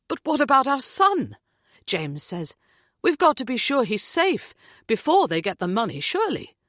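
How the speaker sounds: anxious demand; pleading father. A narrator's reading. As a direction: press the captain for his son. James pleads about son.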